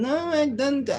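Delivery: high-pitched mocking impression; imitating the official. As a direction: squeak it out like the prissy official. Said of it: "This is exactly how he sounds".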